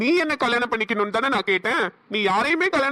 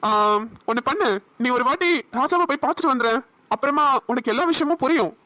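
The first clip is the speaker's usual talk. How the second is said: normal talking voice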